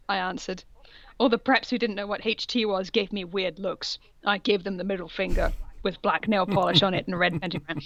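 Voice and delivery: ordinary talk, nothing marked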